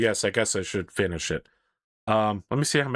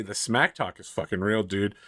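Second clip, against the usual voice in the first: normal talking voice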